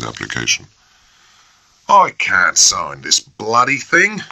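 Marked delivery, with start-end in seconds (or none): 1.9-4.2 slow, louder, peevish complaint; first lieutenant petulantly refusing to sign, slow. A narrator's reading. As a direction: grumble it out irritably. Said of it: "he said peevishly".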